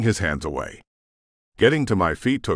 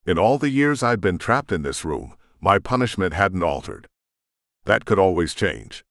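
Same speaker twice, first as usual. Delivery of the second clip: plain reading voice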